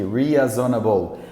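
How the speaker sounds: "Reasonable" slowly as ree-a-zo-na-bol, Brazilian style; spell-read "Reasonable" with a Brazilian accent. Shown as a demonstration. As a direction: Brazilian reading of "Reasonable", every vowel sounded. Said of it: "como é que o brasileiro falaria".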